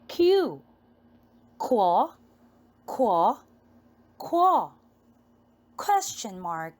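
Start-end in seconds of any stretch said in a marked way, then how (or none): 1.6-4.7 "Qu" as a clipped kw sound; phonics sound. Shown as a demonstration. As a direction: say "qu" as a quick kw sound, not the letter name. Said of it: "This is letter".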